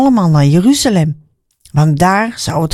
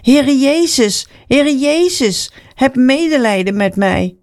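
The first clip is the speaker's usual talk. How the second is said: pleading call; 6 semitones higher than usual. A narrator's reading. Blind man calls to Jesus for mercy, pleading call. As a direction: stretch the name in a high calling tone. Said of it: "begon Bartimaeus te roepen".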